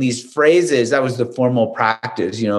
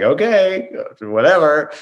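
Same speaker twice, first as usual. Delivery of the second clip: dismissive shrug; 4 semitones higher than usual. Retold or imitated. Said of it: "and she's like".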